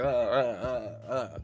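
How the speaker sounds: half-laughing mock alarm, repeating man down; mock stunned shout. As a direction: play it like a fighter knocked flat, crying out. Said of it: "I was like".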